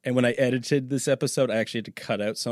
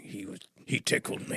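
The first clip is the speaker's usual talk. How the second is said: courtroom defendant excuse; 8 dB quieter than usual. Acted. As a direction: plead your silly case under your breath. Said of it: "I'll be like".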